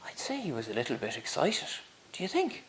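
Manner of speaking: teasing whispered aside. A narrator's reading. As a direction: whisper it to the audience with a wink.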